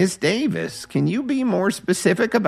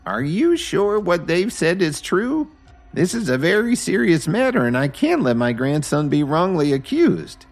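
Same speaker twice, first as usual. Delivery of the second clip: gentle; 10 semitones higher than usual. Acted. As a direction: ask gently but protective of her grandson. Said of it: "asked her gently".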